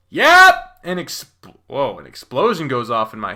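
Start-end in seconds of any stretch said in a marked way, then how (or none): 0.1-0.8 sudden high, loud yelp; cut-off cry, much higher and louder; pained yelp. Acted. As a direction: yelp as if struck mid-word. Struck mid-question, cries out.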